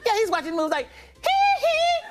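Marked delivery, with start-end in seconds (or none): 1.2-2.0 very high, loud falsetto hee-hee. Retold or imitated. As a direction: squeal out a piercing giggle. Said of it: "He's the loudest person in there".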